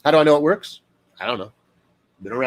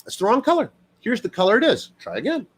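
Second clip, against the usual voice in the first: gentle correcting parent; 9 semitones higher than usual. Retold or imitated. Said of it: "Or are you like".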